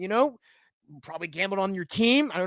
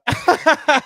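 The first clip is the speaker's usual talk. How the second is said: loud, high burst of laughter; real laughing outburst; 8 semitones higher, 8 dB louder than usual. Real, not acted. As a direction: crack up at your own joke. Cracks up at own joke.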